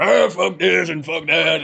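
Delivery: slow, lightly voiced grumbling old man; a hint of a grouchy old guy cursing; slow mock grumble; imitating Nolte. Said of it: "you get Nick Nolte and stuff going".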